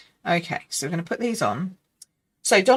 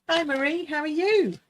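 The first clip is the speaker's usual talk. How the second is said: excited greeting; 10 semitones higher than usual. Real, not acted. Greeting a live viewer.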